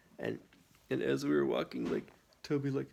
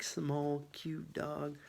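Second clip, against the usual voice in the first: normal talking voice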